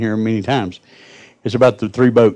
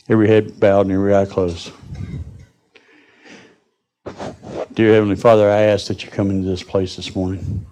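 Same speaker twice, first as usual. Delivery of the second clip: closing prayer. Real, not acted. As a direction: call the church into prayer. Preacher opens closing prayer.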